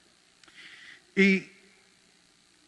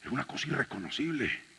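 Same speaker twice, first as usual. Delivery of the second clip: ashamed confession; 5 semitones lower, 8 dB quieter than usual. Real, not acted.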